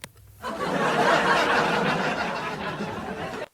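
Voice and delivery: long, loud breathy laugh; burst of laughter. Real, not acted. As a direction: crack up loudly and at length.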